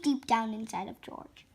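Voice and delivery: usual speaking voice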